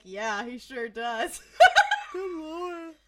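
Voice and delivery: slow words breaking into laughter; laughing. Real, not acted. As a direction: laugh through it, slowly. Laughing slowly.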